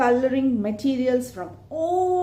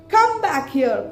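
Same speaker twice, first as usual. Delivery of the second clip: louder angry call after him; 4 semitones higher, 7 dB louder than usual. Acted. Raven calls after the fleeing owl, louder and angry. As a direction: call him back, furious. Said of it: "called the raven angrily".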